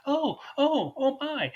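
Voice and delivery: very high, fluttery oh my; flustered lady. Retold or imitated. Disguised man caught out, squeaking in a high voice. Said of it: "And she's like".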